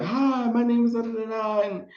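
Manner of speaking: high mock host opening the show; cheesy talk show host. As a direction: ham up a TV host welcoming the crowd. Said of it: "the guy's like".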